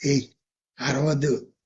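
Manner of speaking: low, softened hushing; retold confidential aside; imitated word in the ear. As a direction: quietly warn someone not to yell. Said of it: "దగ్గరికి వచ్చి చెవిలో చెప్తుందట".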